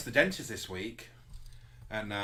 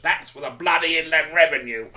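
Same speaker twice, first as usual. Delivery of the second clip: loud, higher laughing shout; mock shout; 6 semitones higher, 13 dB louder than usual. Landlady smacking a man's backside, loud mock shout. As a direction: whack and yell it gleefully. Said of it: "whacks the bloke ... and goes".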